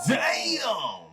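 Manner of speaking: high, loud burst of disbelief; shocked shout. Real, not acted. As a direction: yell it as the hit lands. Shocked reaction to clip.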